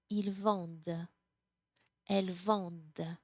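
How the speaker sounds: Ils vendent, elles vendent end on a clear D, no en sound; silent ent ending. Shown as a demonstration. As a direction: vendent said as vɑ̃d. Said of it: "So we just hear that D".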